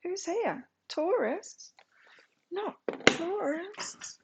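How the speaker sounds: high, swooping coo to an animal; pet-talk voice. Real, not acted. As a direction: talk to an unsettled pet, pitched right up. Fusses over an unsettled pet.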